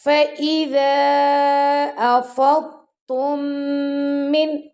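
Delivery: slow, drawn-out melodic Quran recitation; tajweed recitation. Shown as a demonstration. Teacher reciting the verse for students. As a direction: chant each verse slowly with tajweed.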